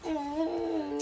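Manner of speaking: soft, groggy protest; drowsy and irritated, very quiet; sleepy grumble. Acted. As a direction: mumble it half asleep. Sleeping son being woken.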